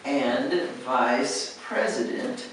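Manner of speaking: slow, careful, word by word; slow clear delivery; said slowly. Shown as a demonstration. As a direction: spell it out slowly for the interviewer. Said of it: "So if you say".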